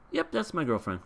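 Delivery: put-on unhesitating boyfriend claim. Grandson answers his teasing parents without skipping a beat, lightly acted unhesitating claim. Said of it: "without even skipping a beat, he's just like".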